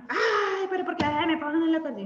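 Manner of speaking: high, fast, whiny protest; irritated complaint. Acted. Preacher voicing an irritated relative about a pencil case, whiny. Acting an irritated family member.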